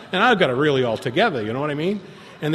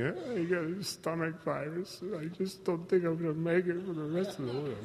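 mock sick caller; 4 semitones higher, 10 dB quieter than usual. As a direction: imitate a feeble excuse on voicemail. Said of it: "he did the same thing".

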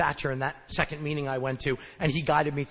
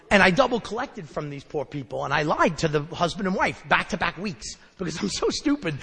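ordinary delivery

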